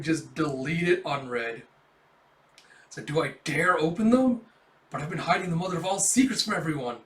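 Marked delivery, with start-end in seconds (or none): none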